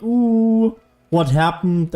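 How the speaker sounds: ordinary delivery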